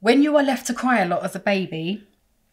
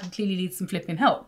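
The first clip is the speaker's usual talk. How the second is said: ordinary talk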